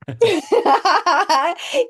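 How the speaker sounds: bright, loud, high laugh bursting out; delighted laugh. Real, not acted. Guest laughing when asked how she met Levi, high delighted laugh.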